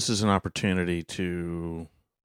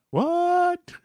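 surprised. Retold or imitated. Friend discovers cheap whiskey trick.

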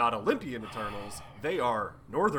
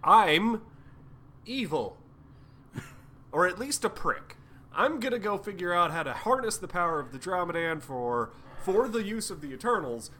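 higher, louder mock evil gloating; hammed-up villain; 5 semitones higher, 3 dB louder than usual. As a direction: announce your evil plan with theatrical glee. Druig voiced as schemer.

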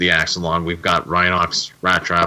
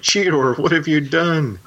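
high, alarmed mock panic; freaking out; 9 semitones higher than usual. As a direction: cry out at Cheetor in hammed-up alarm. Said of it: "And Rhinox freaks out".

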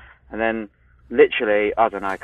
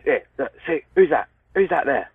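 lightly voiced dad, raised pitch; dad pointing someone out; 6 semitones higher than usual. Dad to teenage son at the CCTV, insistent dad voice. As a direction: urge the boy to look, pitch up. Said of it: "my dad comes upstairs".

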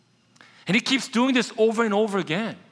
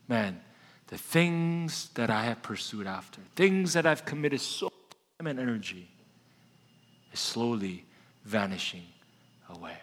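hushed and fading, almost to nothing; wistful realization; 8 semitones lower, 7 dB quieter than usual. Acted. Preacher voicing us realizing our chase vanished, hushed and fading. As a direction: let the words dissolve as you realize it is gone.

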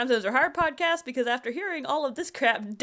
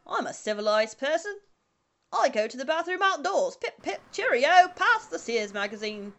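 mock English accent. Podcaster showing off a civilized person, mock English accent. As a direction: play a caricature Englishman with a silly English accent. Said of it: "Is that what you think English people sound like?".

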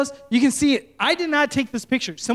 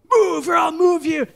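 higher, louder furious shout; angry shout; 6 semitones higher, 4 dB louder than usual. Retold or imitated. Enraged driver shouting at the pastor, furious shout. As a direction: yell it right in his face. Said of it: "He's so angry".

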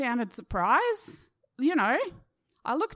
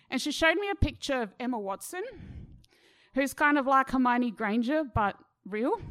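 usual speaking voice

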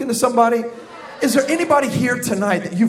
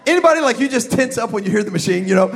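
normal talking voice

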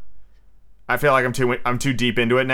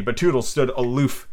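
usual speaking voice

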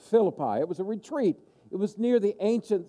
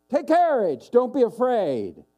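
higher, louder call over the storm; 6 semitones higher, 5 dB louder than usual. Retold or imitated. Jesus calling to disciples in the boat, loud. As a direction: shout comfort across the waves. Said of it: "he cries out".

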